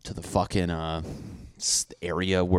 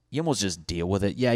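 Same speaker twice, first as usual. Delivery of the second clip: normal talking voice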